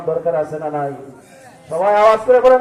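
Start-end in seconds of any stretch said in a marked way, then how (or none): 1.7-2.6 loud, higher call to the crowd; rally the congregation to answer aloud; raised preacher's shout. Real, not acted. Calls congregation to respond.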